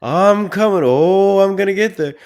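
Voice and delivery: put-on high, loud whining baby voice; whiny man child. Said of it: "He's, like, whining like a baby".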